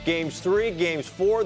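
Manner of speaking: ordinary talk, nothing marked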